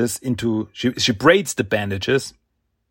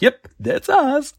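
much higher, slow, eager Mikey impression; cheery answer; slower than usual. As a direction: bounce it out proudly.

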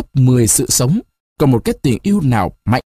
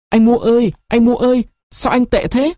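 high, urgent pleading call; a girl calling her lover more urgently; 11 semitones higher than usual. A narrator's reading. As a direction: beg him to answer. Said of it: "gọi to hơn, khẩn khoản hơn".

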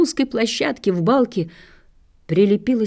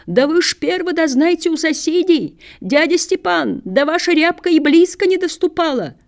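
put-on wailing old man; 8 semitones higher than usual. A narrator's reading. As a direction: wail like a beaten old man begging his neighbours. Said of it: "И этот воющий голос".

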